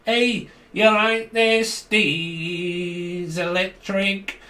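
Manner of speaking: high, slow, drawn-out Scouse accent; exaggerated Scouse. Shown as a demonstration. Showing Americans the accent, exaggerated Scouse. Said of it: "That's a bit, you know, exaggerated".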